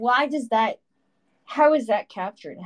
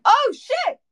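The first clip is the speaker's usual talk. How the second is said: much higher, louder startled cry; 7 dB louder than usual. Real, not acted. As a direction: jump at the sudden noise. Startled by the mic sound.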